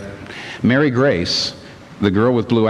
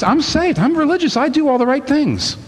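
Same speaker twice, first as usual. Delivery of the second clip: much higher, prim self-righteous voice; smug churchwoman. Retold or imitated. Preacher mocking Mrs Turpin's self-righteous boast. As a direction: lift into a high prissy whine of virtue.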